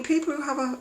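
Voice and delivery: ordinary talk, nothing marked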